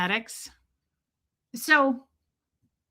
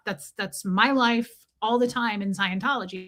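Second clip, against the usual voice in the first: usual speaking voice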